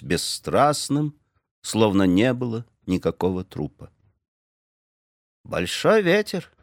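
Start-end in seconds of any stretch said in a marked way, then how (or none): 5.4-6.5 high, slow greeting; calm terse greeting; Indian greeting. A narrator's reading. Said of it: "сказал индеец в виде приветствия".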